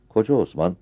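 ordinary delivery, nothing marked

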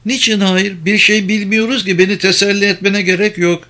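high-pitched woman's role; Penelope, objecting. Acted. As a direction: lift the pitch, protesting to a friend.

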